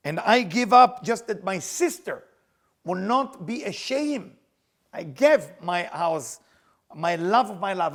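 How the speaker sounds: Rachel pressing God with her own sacrifice; impassioned plea. Acted. Rachel confronts God, pleading.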